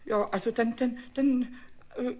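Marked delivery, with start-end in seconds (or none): none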